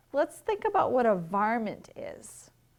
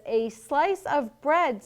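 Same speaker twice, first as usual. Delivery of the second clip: slow, louder, each word stressed; emphatic repeating; 5 semitones higher, 5 dB louder, slower than usual. Acted. Mother repeats to a son not listening, emphatic. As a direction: spell it out slowly and firmly.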